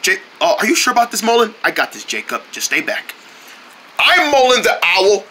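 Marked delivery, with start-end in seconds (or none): 1.6-3.1 low, soft word to a friend; quiet reassurance. Acted. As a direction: hush him and take charge. Reassures friend before confronting stranger.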